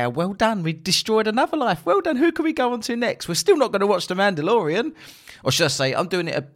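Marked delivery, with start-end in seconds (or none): 0.0-4.9 high, smug mock voice; mocking the woke mob. Podcaster mocking Twitter mobs in a smug high voice. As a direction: sneer as a self-congratulating troll. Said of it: "pat themselves on the back and say".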